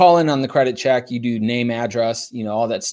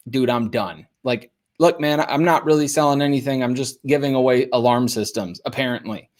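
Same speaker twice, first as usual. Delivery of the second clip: ordinary talk, nothing marked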